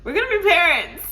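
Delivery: high and loud, a little fast; excited announcement. Retold or imitated. Telling her partner in the car, high and excited. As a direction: burst out with the big news. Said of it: "I'm letting him know".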